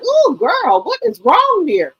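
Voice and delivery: put-on high, sassy "ooh girl"; a scandalized friend reacting; mock shocked bank account. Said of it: "my bank account screaming like".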